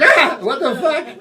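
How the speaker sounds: startled yelp, high and loud; sudden high cry of alarm. Acted. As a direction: jump out of your skin. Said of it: "fucking startle you".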